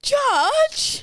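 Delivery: high, alarmed cry of a name; acted shriek of alarm; horrified call. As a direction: cry out on discovering a disaster. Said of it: "I wanna see real acting here".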